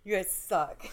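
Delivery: laughing through mock offence; laughing protest. Real, not acted. Guest teased by both co-hosts, laughing protest. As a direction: grumble at your friends while cracking up.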